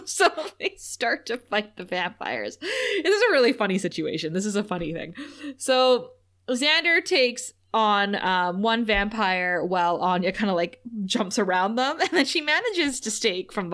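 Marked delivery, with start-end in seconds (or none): none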